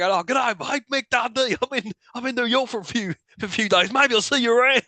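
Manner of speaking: lightly voiced chatty Australian; imitating Mick Dundee. Mick hailing a random passer-by from the limo, imitated chattiness. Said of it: "Mick just starts talking to a random".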